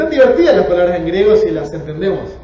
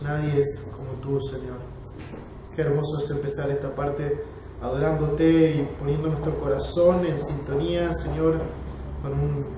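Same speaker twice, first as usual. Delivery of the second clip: earnest congregational prayer, settling quieter toward the amen; 3 semitones lower, 4 dB quieter than usual. Real, not acted. Teacher praying to God before the Bible class, prayer. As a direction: pray reverently, in unhurried phrases with pauses.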